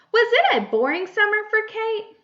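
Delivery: loud, high question opening; question tune. Shown as a demonstration. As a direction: ask the class, pitching it high and loud. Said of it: "This is a question".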